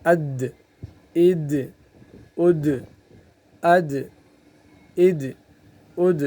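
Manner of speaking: اد with a bounce on the final د; اد قلقلہ کے ساتھ; qalqala drill. Shown as a demonstration. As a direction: let the د in اد echo as qalqala. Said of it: "حرکت دینا ہے مخرج کو".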